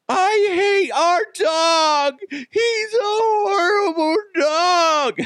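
put-on high, slow wailing child's shout; crying kids. Dad imitating his kids crying over the killed bird, high wailing shout. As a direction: wail like a heartbroken kid.

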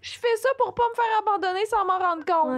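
high, teary voice breaking; emotional crying. Real, not acted. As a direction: near tears, laughing through it. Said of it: "Je vais pleurer".

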